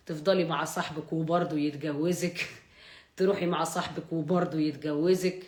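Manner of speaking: mock Egyptian Arabic, teasing and sly; Egyptian dialect. Goaded to keep talking Egyptian, teasing in a light Egyptian accent. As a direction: keep up the Cairene talk, lightly hammed. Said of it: "كمل أحكي بالمصري".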